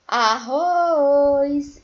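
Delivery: "Arroz" half-sung loud, RROZ held long; sing-song stretch. Shown as a demonstration. Teacher showing the technique on Arroz, sing-song stretch. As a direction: chant "Arroz", drawing out the final RROZ. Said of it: "quando você faz essa técnica".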